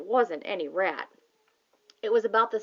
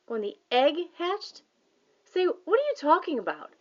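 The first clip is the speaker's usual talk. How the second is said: puzzled stranger; 8 semitones higher than usual. A narrator's reading. Sleepy stranger on the phone puzzled by a boy. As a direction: sound confused and sleepy.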